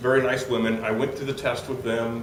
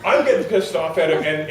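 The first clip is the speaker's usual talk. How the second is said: ordinary talk, nothing marked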